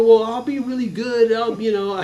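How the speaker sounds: put-on high, loud bargaining child; mock kid wheedling mom; pleading little kid. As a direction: beg like a boy promising to behave.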